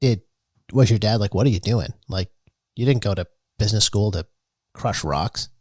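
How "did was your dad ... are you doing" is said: usual speaking voice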